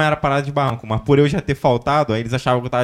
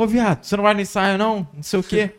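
lightly mimicked high-pitched call; retold calling out; 8 semitones higher than usual. Bandmate passing the ravine asks about rehearsal, high mock call. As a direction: imitate a mate hailing someone, pitched up.

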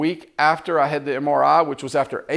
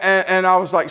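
ordinary talk

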